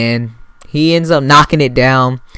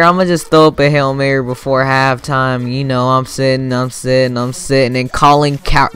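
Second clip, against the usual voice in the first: ordinary talk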